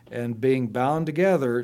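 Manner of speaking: normal talking voice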